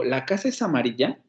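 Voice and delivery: "La casa es amarilla" asked, rising at the end of "amarilla"; question intonation. Shown as a demonstration. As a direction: move the weight to the end, lifting the last syllable of "amarilla". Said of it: "hago una pregunta y digo".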